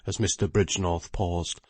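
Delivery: normal talking voice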